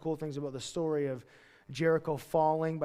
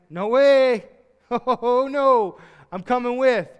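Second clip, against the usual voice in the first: loud, high protest; insistent acted outburst; emphatic refusal; 6 semitones higher, 11 dB louder, much slower than usual. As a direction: refuse outright, voice raised and jumping up. Acted refusal to be left behind.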